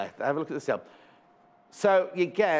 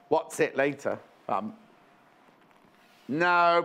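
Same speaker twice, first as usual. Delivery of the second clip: ordinary talk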